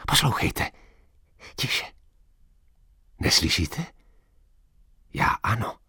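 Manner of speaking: breathy near-whisper, very soft; hushed whisper. A narrator's reading. Old man hushes his companion to listen, whispering. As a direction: lean in and whisper so nobody hears.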